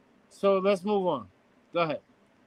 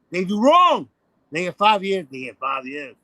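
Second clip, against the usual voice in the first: ordinary delivery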